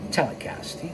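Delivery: breathy unvoiced whisper of Telecast; whispered repeat. Shown as a demonstration. Narrator to listeners, whispering Telecast. as a hint. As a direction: whisper Telecast. again as a sly aside. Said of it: "You knew what I was saying".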